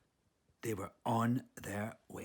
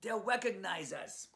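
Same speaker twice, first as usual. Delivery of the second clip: Brooklyn Chihuahua; 8 semitones higher, 4 dB louder than usual. Acted. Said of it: "Leon reminded her".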